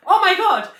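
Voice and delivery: high, loud laughing gasp; imitating her friend's high, loud squeal of shock; shocked cry. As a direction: squeal it in disbelief. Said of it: "You literally said that".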